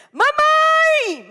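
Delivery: very high, loud shout of mamãe; child's shout. Retold or imitated. Boy yelling for his mother from the kitchen door, very high and loud. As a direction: yell it like a furious little boy. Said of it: "e gritou".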